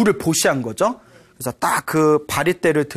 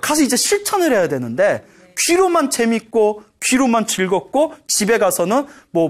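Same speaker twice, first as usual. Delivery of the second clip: ordinary talk, nothing marked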